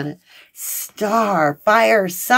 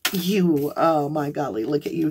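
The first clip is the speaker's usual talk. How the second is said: ordinary delivery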